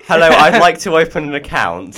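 jokey bank-customer voice at the cheese bank; mock bank customer. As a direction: play a customer opening a cheese account, overdone.

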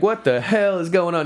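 put-on Southern cop shouting at kids; Southern cop accent. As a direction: play a Southern cop demanding answers. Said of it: "really thick Southern accent".

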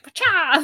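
high, loud mock punch noise; punching sound effect. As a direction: shout out a playful punch sound. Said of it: "enjoyed my punching sound effect".

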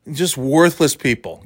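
ordinary delivery

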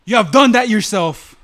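dramatic movie Jedi reprimand, drawn out; acted Obi-Wan retort. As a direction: throw the accusation back forcefully. Acting Obi-Wan's reply in scene.

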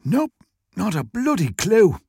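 wheezy shopkeeper complaining; burly Irish shopkeeper. A narrator's reading. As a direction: grumble as a put-upon Irish shop owner.

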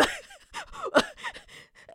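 a long, high rising laugh; high giggle. Real, not acted. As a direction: giggle up high before finishing the thought. Laughs mid-sentence.

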